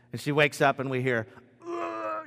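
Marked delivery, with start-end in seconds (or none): 1.7-2.2 a short high mock retching groan. Dad imitating his daughter retching in the night, a high mock groan. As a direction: imitate a child about to throw up, high and quick. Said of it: "do you wanna know exactly what it sounds".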